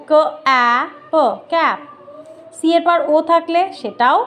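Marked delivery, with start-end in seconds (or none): 0.1-1.8 ক, অ্যা, প one by one, then ক্যাপ; phonics sounding out. Shown as a demonstration. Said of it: "ক এর মতো সাউন্ড হবে".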